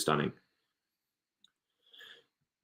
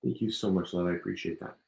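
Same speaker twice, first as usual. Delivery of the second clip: soft thanks; 3 semitones lower, 9 dB quieter, faster than usual. Real, not acted. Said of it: "my voice is going".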